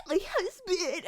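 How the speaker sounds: crocodile tears. Retold or imitated. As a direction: fake sobbing, high-pitched. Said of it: "I did crocodile tears".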